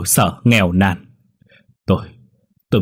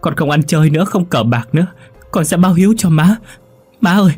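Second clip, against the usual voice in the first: climbing higher and louder, begging through tears; sobbing plea; 8 semitones higher, 4 dB louder than usual. A narrator's reading. Đại begs his wounded mother not to die, sobbing ever higher. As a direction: promise everything to a dying mother, voice breaking. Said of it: "Anh ôm bà trong lòng mà khóc nức nở".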